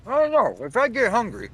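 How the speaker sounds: high, loud teasing threat; playful menace. Acted. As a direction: joke like a hungry cannibal. Mock menacing joke.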